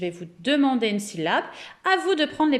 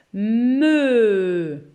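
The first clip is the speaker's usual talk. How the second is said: very slow sounding out; 5 dB louder than usual. Shown as a demonstration. Teacher to pupils, drawing out mi very slowly. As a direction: drag every sound of pour écrire mi. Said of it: "j'aimerais bien que vous m'écriviez".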